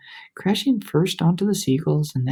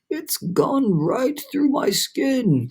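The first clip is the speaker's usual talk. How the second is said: mild growl; 8 semitones higher, much slower than usual. A narrator's reading. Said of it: "the earthworm growled".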